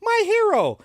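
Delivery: high, breathless swoon; swooning damsel. Acted. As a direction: sigh it like a rescued heroine.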